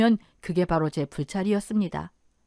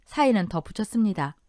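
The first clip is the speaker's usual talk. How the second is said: plain reading voice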